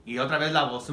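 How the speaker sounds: normal talking voice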